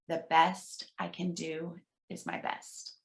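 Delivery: soft, slow hushed repeat; half-whispered echo. Shown as a demonstration. Leader softly repeats the affirmation for a muted group, hushed. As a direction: hush it back gently for the group. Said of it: "I'll whisper it kind of in the second one".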